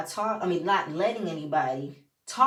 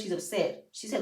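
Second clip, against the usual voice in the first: ordinary delivery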